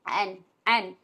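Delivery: and said short, with a clear final d; and pronounced cleanly, not stretched; say and right. Shown as a demonstration. Teacher demonstrates how to say and to students. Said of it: "आप इसको and बोलिएगा बस".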